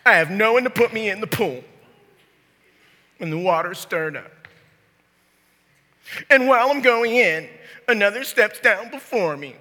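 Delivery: loud, high-pitched excuse-making; sick man's complaint. Acted. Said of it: "I'm gonna read it how I hear it".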